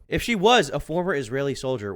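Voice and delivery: usual speaking voice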